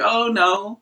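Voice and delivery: high, nervous little "oh no"; nervous giggle. Retold or imitated. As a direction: imitate an awkward, giggly refusal. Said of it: "laughs and giggles nervously".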